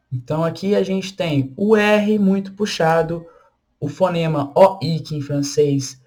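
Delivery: ordinary delivery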